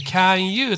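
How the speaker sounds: "can you" split into "kyan" and "yuu"; unlinked mispronunciation. Shown as a demonstration. Teacher quotes learners' error, "can you" said apart. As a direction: say "can you" loudly with no linking. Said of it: "これは間違いですので".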